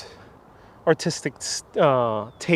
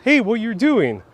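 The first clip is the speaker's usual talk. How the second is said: acted monkey; 6 semitones higher than usual. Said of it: "and the monkey says".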